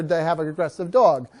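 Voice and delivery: ordinary delivery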